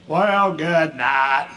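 put-on very slow drawn-out old man; dad's stalling good night. Dad stalling before telling his son. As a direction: drag it out before the news.

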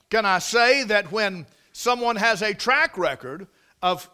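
normal talking voice

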